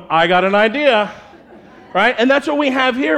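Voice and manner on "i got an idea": louder, proud announcement of a dumb plan; buddy boasting to his friends about a plan; eager dude. Retold or imitated. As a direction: sell the idea to the guys. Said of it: "group of dudes going".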